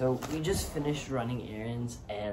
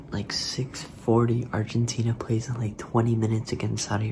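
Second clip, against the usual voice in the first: whispering. Real, not acted. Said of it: "I have to whisper".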